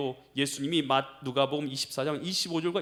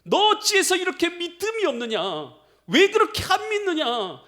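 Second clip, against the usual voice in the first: high, forceful reproach; rebuking; 11 semitones higher, 4 dB louder than usual. Acted. Jesus scolding disciples for unbelief, high rebuke. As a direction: demand an answer, voice pitched high. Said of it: "믿음이 없는 것을 책망하시는".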